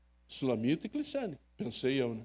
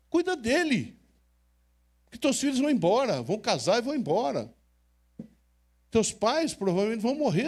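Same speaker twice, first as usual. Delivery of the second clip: raised, urgent sermon push to the crowd; fervent preaching; 5 semitones higher than usual. Acted. Pastor urging couples to care for each other, fervent preaching. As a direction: drive it home like a pulpit appeal.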